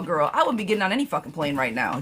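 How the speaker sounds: ordinary talk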